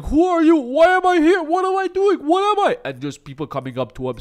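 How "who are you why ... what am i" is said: much higher, louder panicked yelling; confused screaming. Retold or imitated. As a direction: shriek it like a lost, bewildered old man. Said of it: "him just pointing fingers at everyone saying".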